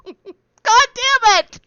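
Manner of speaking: loud, high, drawn-out laughing curse; exasperated outburst. Real, not acted. Player curses at the game master's news, loud and exasperated. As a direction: burst out in amused exasperation, stretching the words.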